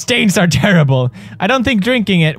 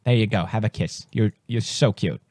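sweet-talking the little spider, softer and softer; tender cooing; 6 semitones lower, 4 dB quieter, faster than usual. Real, not acted. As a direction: coo to it like a puppy.

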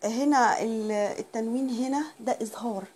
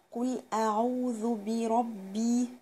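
slow, drawn-out tajweed reading; slow chanted model line; slow recitation; much slower than usual. Shown as a demonstration. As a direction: stretch every syllable of قل أعوذ بربي.

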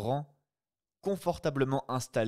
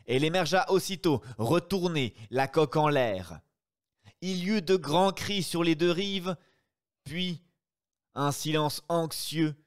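excited narration; 6 semitones higher, 4 dB louder than usual. A narrator's reading. Narrator at the boat capsizing.

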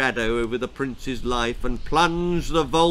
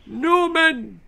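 high, louder firm repeat; insistent old king; 6 dB louder than usual. A narrator's reading. King overruling his queen, insistent. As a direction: repeat it, brooking no argument. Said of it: "reiterated the king".

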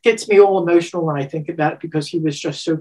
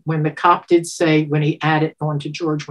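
ordinary talk, nothing marked

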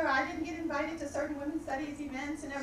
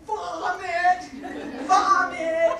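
loud laughing cry of vomit, higher; mock disgust; 4 semitones higher, 10 dB louder, much slower than usual. Rejecting Eat Pray Love at the bookstore, loud laughing mock disgust. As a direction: shout it out in joking revulsion. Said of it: "I was like".

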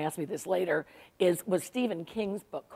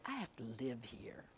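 hushed, unvoiced whisper of a warning; 7 semitones lower, 19 dB quieter than usual. Older sister on the phone warns the author, whispered. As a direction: breathe it like a secret warning about the book.

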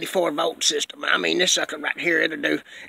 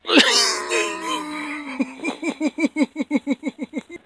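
high wheezing laugh; 6 semitones higher than usual. Real, not acted. Breaks into long laughter.

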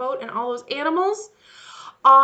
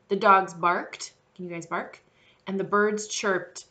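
ordinary talk, nothing marked

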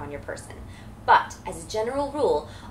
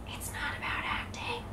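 hushed secret, whispered; soft stage whisper to the viewer; confiding whisper; 10 dB quieter than usual. Pretend. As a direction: whisper it like a surprising reveal. Lets slip a surprising secret.

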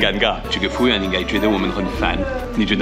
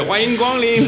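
shop greeting; 8 semitones higher than usual. Retold or imitated. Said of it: "中国一进来就是".

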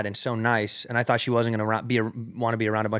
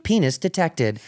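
robot voice; 5 semitones higher, slower than usual. Retold or imitated. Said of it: "all these different robot sounds".

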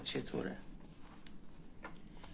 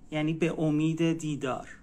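ordinary talk, nothing marked

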